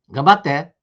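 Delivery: ordinary delivery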